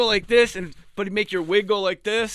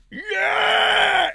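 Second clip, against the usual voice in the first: triumphant yell. Retold or imitated. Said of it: "he just went".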